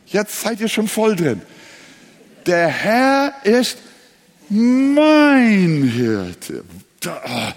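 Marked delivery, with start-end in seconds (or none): 2.4-6.6 "mein" higher and louder, drawn out; emphasis on "mein", loud and long; stress on mein. Shown as a demonstration. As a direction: lean hard into "mein". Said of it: "Nächstes Wort".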